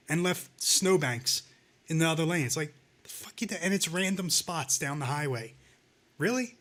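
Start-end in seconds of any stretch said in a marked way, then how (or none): 6.2-6.5 high, sarcastic disbelief; incredulous. Real, not acted. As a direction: throw it out in mock astonishment. Mocking disbelief at highway plowing.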